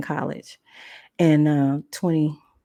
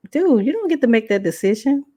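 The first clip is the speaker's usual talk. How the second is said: higher-pitched retort to a meddler; indignant pushback; 7 semitones higher, 4 dB louder than usual. Retold or imitated. Young woman talking back to her play father, indignant. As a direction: imitate telling him off, incredulous. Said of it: "This is how I talk to him".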